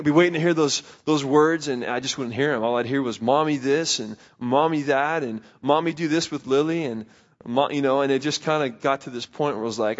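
usual speaking voice

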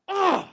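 high, pained groan at himself; angry ugh. Retold or imitated. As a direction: groan in self-directed anger. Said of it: "anger at myself".

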